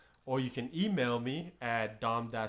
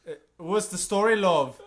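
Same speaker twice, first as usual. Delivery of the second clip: higher, louder put-on Irish accent; bad Irish accent; 6 semitones higher, 8 dB louder than usual. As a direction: attempt an Irish chat-up voice. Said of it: "the Irish accent".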